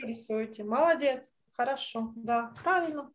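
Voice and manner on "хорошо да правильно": ordinary delivery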